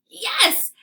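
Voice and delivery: high, loud cheer of joy; joyful yell. Acted. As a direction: yell it out with delight. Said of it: "Hattie's joyful voice yells".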